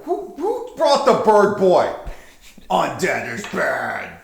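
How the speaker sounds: high, loud exasperated jab; exasperated necromancer. Acted. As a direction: scoff at the bird boy's slogan. Necromancer mocks the bird player.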